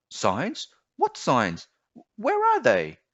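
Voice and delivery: rising to a high, loud call after the bird; young boy. Acted. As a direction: call out, confused and alarmed.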